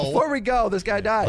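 loud, high parody of a host's segue; mock announcement. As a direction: blurt it out big, mimicking a co-host's clumsy announcement. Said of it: "not like me where I go".

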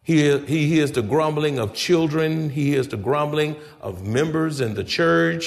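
ordinary delivery

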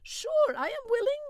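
high, eager peasant voice; eager Belhomme. A narrator's reading. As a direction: jump at the offer.

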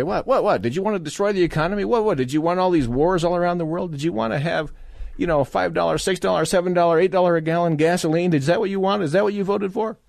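mock confrontation. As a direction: needle them with a fast, high, hammed-up what-what-what. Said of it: "and say".

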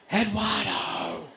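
long drawn-out call of a name; stretched shout across the campsite. Retold or imitated. Calling out for Eduardo.

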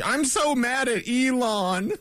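mock outrage. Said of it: "the faux outrage".

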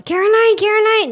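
high, loud searching call; shouting for her. Retold or imitated. Julian shouting for Caroline in the woods, imitated shout. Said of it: "He was shouting for her".

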